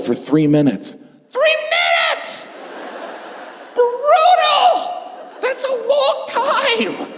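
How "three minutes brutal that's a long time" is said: squealing outrage. Acted. Comic outrage at long nosedive.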